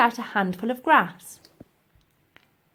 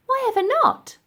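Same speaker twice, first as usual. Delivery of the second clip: put-on high, eager young girl; a bright little girl's voice, pitched higher; 5 dB louder than usual. Elsie urging the professor, high girlish voice.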